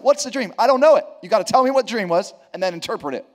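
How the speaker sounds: a king who wants answers now; demanding Pharaoh. Acted. Pharaoh pressing his soothsayers, impatient and loud.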